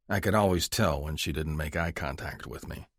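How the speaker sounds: plain reading voice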